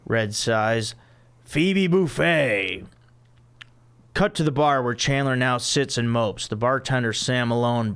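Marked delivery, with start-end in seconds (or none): 1.4-2.9 reluctant announcement. Acted.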